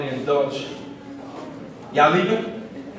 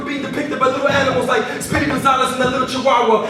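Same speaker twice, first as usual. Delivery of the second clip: driving slam poem delivery, higher and louder than chat; spoken word poetry; 9 semitones higher, 4 dB louder than usual. Acted. Poet to the audience about the children of the wind, rhythmic slam delivery.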